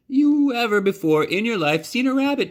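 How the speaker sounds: put-on a nervous kid's sales pitch; showman boy. Bryce offers the dancing rabbit to Neil, high showman's voice.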